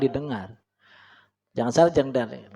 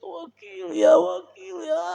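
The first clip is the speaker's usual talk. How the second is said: much higher, crying plea; mock weeping prayer; slower than usual. Shown as a demonstration. Preacher imitating a weeping supplicant, mock sobbing. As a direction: wail it like tearful begging. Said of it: "biasa nangis-nangisan itu".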